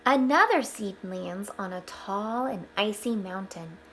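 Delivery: bright storybook read-aloud; gentle storyteller voice; reading to children. Acted. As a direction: read it out like a picture book for the class. Reading picture book aloud to children.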